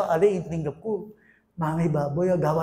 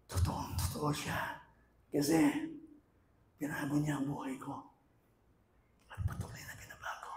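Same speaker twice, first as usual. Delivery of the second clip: hushed testimony; 6 semitones lower, 16 dB quieter than usual. Real, not acted. Preacher confiding his changed life to congregation, hushed. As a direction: drop to a soft near-whisper.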